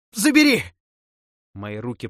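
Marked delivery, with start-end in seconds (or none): 0.1-0.6 far higher and louder, a near-shriek; the last desperate plea torn out; shrill scream. Acted. Necromancer screams at the death god to take it, shrill.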